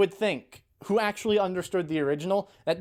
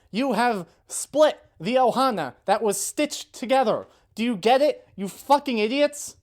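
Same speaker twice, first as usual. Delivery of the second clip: raised, higher-pitched exasperated rant; really mad; 5 semitones higher, 3 dB louder than usual. Real, not acted. Scolding the writers for splitting the family, real anger. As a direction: tear into them, stumbling over words in frustration. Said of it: "I'm actually mad".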